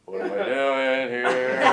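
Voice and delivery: slow, drawn-out bewilderment; bewildered inner thought. Retold or imitated. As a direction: stretch out the retold thought. Retold bewildered thought at table.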